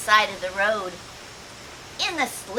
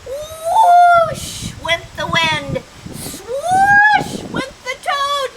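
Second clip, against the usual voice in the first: high, loud, slow whoosh; whooshing wind; 7 dB louder, much slower than usual. A narrator's reading. Reading to kids as wind blows, loud drawn-out whoosh. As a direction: blow it out like wind.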